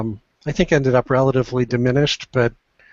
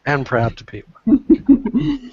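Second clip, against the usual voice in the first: higher, slow, grandly drawn out; mock grand pride; 9 semitones higher, much slower than usual. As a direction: savour every word proudly. Echoes praise in mock grandeur.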